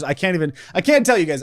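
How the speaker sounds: ordinary talk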